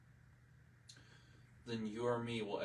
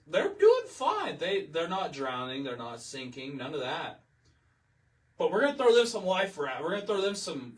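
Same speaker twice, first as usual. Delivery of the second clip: hammed-up careless official, voice jumping much higher and louder; mocking government voice; 4 semitones higher, 8 dB louder than usual. Mimicking the government eyeing Israel's raft, hammed-up and loud. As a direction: sneer as the government tossing out aid. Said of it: "and they say".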